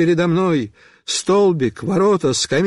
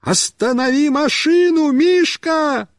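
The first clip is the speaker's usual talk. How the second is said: high, urgent holler; shouting; 9 semitones higher than usual. A narrator's reading. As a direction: call for help across the yard. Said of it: "и закричал".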